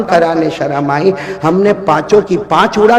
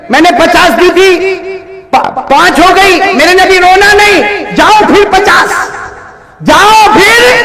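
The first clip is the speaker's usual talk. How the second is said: builds from raised to a higher, louder call; rising shout; 12 semitones higher, 4 dB louder than usual. Acted. Preacher voicing God reassuring the Prophet, rising to a shout. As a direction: swell it up into a proclamation.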